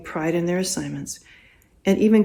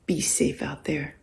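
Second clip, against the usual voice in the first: gentle whispered send-off; 3 semitones lower, 8 dB quieter than usual. Retold or imitated. Bob's parting line to guests.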